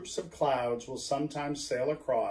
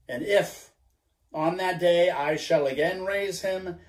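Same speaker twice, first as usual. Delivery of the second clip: louder, weighty promise; solemn vow to the crew; 3 dB louder than usual. Acted. Ahab promises the gold to his men, solemn. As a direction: swear it before all hands.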